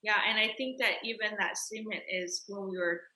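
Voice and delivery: normal talking voice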